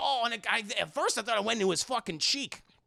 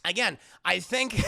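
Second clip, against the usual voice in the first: usual speaking voice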